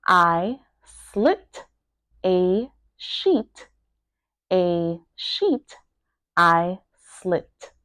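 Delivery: broken into separate words with pauses. Shown as a demonstration. Teacher breaking down a tongue twister, word by word. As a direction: pronounce each word on its own. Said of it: "make sure that you are pronouncing each word".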